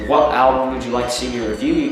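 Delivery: usual speaking voice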